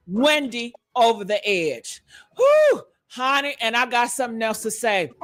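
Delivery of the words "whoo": high whoop of joy; excited whoop. Real, not acted. Host hyping her audience about Wendy news, high whoop. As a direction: let out a loud celebratory woo.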